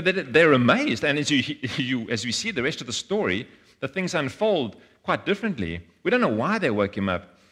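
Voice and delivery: ordinary talk, nothing marked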